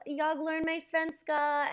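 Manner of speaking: a careful beginner's "jag learn mig svenska"; halting Swedish. Shown as a demonstration. Expat telling a shop clerk she's learning Swedish, slow careful Swedish. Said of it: "that's like, I'm learning Swedish".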